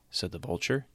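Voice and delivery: usual speaking voice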